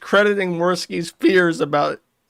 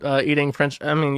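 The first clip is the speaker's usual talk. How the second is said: ordinary talk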